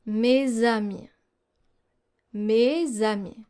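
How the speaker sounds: mes amis slowed, the z onto amis clear; slow z liaison. Shown as a demonstration. Teacher slowly modelling the z liaison for learners. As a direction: draw out mes amis, stressing the z link. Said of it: "but rather like a Z".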